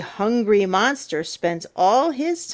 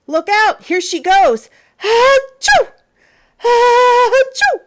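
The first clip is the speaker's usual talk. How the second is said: loud high achoo sneezes; hammed-up comic sneezing; big sneeze; 9 dB louder than usual. Monster sneezing at reader.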